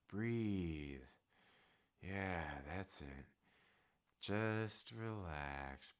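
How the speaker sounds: very soft, hushed guided breathing, drifting into whisper; soothing breathe-in breathe-out guide for a nervous friend; calming breathing coach. Pretend. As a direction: talk him down gently, like a meditation tape. Mock meditation to calm nervous Lucas.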